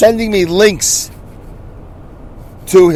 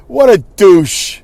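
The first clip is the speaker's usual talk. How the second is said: slow, louder and drawn out in open contempt; slow scornful verdict; 3 semitones higher, 4 dB louder, much slower than usual. Real, not acted. Telling listeners about the rude father, slow and scornful. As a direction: spell out the contempt word by word. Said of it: "I don't care who hears that".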